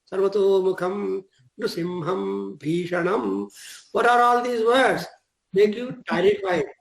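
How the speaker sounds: ordinary talk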